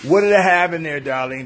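ordinary talk

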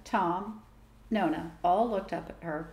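plain reading voice

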